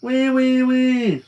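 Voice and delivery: high, loud mock piggy squeal. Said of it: "Boone said".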